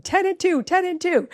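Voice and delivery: high-pitched nervous repeated reminder; anxious mock instructing. Mom retelling coaching her teen at the wheel, anxious and high. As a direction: re-enact a jittery driving instructor. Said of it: "so heated and anxious".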